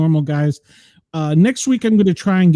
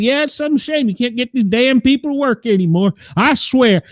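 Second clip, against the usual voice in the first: a hammed-up Southern twang, pitched higher; mock Southern drawl; 7 semitones higher than usual. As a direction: play the Southern good old boy, putting on a twang. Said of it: "the more Southern I'd get".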